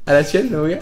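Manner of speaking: ordinary delivery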